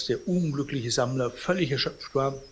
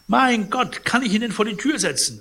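flustered protest; 10 semitones higher, 6 dB louder than usual. Acted.

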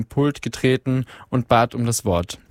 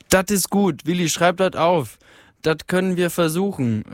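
higher, Ruhr-dialect miner calling out from the hall; calling voice from the crowd; 6 semitones higher than usual. A narrator's reading. A miner in the crowd calls to Willi, higher Ruhr-dialect call. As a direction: shout it up from the floor in broad Ruhrpott. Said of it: "rief eine Stimme".

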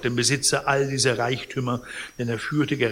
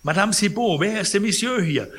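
high, loud demand; called out; 9 semitones higher, 6 dB louder than usual. A narrator's reading. Sick man calls his housekeeper about the stranger, high loud cry. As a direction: call out in alarm, pitched up. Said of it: "rief er".

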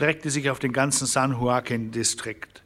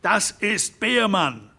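higher, slow, louder outcry; shouted; 7 semitones higher, 3 dB louder, slower than usual. A narrator's reading. Harren Derrick naming the culprit to the ranchers, shouting. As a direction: cry the name out in alarm. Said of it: "schrie Harren Derrick".

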